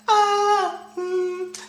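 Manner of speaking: high gasp and squeal; high giddy gasp and whimper; excited gasp. Real, not acted. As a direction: gasp like you can't contain it. Overcome by excitement.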